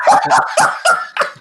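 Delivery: high unvoiced laugh. Real, not acted. His embarrassed laugh after spitting.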